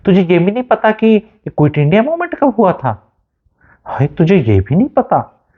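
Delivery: mock them for not knowing; mocking taunt. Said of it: "कितना उनका उपहास उड़ाया था".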